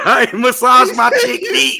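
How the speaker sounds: high, loud, cracking up; helpless high-pitched laughing delivery; laughing hard. Real, not acted. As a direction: barely get it out through real laughter. Cracking up over a read comment.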